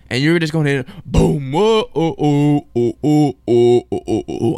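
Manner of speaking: loud chanted whoa-oh goal song; goal chant imitation. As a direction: belt out the goal celebration. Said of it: "that dang cannon".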